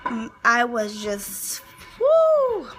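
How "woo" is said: a sudden high falsetto "woo"; high whoop. Retold or imitated. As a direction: let out a giddy high-pitched whoop. Overwhelmed, delirious at the time.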